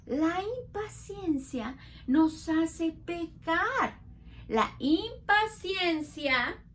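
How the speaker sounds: slow, weighty lesson line; teacher stressing impatience to children; slow emphatic teaching. Shown as a demonstration. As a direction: lay each word down slowly. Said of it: "teacher stresses lesson slowly".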